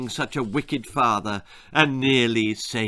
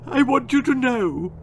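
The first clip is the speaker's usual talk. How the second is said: high, tearful, pitiful; sobbing; 12 semitones higher, 4 dB louder than usual. Acted. Daughter to her parents, high sob. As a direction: sob it out bravely. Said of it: "Gwendolen sobbed".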